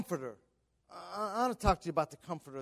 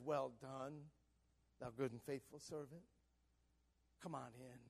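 very soft, low Savior's voice; hushed, reassuring quote of the Savior; gentle welcome; 5 semitones lower, 15 dB quieter than usual. Retold or imitated. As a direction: welcome them home tenderly and quietly.

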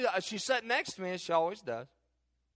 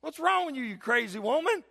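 mock retort; 8 semitones higher, 4 dB louder than usual. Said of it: "I'm like".

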